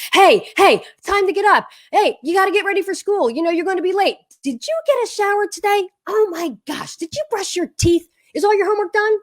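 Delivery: high, insistent morning nagging; mock nagging parent rousing a kid for school. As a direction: rattle off reminders like a harried mom. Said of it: "directing and correcting and nagging and hagging".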